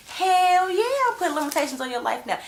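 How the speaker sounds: mock fired-up retort. Speaking for the wife, she fires back at Apollo loudly. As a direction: snap back at him loud and high.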